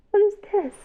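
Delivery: put-on high, bright question to little kids; reading to a toddler. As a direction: ask it like a storytime guessing game. Asks children during song.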